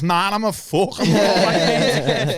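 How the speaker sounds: high, laughing fake reassurance; laughing denial. Answering strangers who ask if he's okay, laughing. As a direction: brush it off with a laugh. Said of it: "I'll be like".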